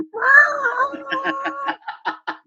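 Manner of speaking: very high, long imitated cock-a-doodle crow; rooster crow. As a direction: crow like a rooster answering the call. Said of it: "I just hear this rooster go".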